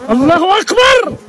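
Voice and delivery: high-pitched cry of awe; exultant cry. Real, not acted. Beekeeper marvelling at a honey frame, exultant. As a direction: exclaim it high, in real wonder. Praising God at full honeycomb.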